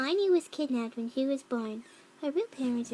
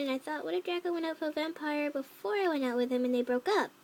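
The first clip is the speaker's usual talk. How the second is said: usual speaking voice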